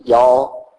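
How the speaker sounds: y'all in a Southern drawl. Shown as a demonstration. Preacher jokes, giving the Southern word y'all. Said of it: "good Southern terminology".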